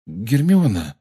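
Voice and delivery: hesitant, tentative calling of a name; uncertain. A narrator's reading. Harry to his friend as the song changes, uncertainly. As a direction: say it unsurely, half asking. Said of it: "неуверенно произнёс Гарри".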